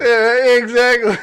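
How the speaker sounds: high, loud delighted cackle; laughing with glee; gleeful laughing. Real, not acted. Gleeful at the offense bait.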